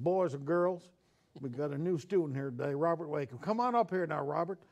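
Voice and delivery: lightly voiced Southern nun with a Virginian drawl calling a new boy up. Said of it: "she had this Virginian drawl".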